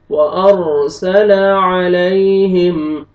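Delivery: slow Quranic recitation. Shown as a demonstration. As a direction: recite the verse in a drawn-out chant. Recites the phrase.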